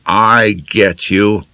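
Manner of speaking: slow, hearty upper-class drawl; Beaky's voice. A narrator's reading. Beaky agreeing with Lena, slow toff voice. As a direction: play the silly toff slowly. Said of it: "Beaky nodded sagely".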